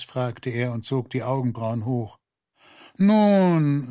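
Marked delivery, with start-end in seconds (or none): 3.0-3.9 high, loud, resonant opening word; sonorous. A narrator's reading. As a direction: let it ring out, long and full. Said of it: "mit klangvoller Stimme". (mit klangvoller Stimme)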